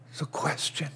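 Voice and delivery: breathy unvoiced whisper, almost silent. Real, not acted. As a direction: lean in and whisper it to the congregation. Preacher drops voice to aside.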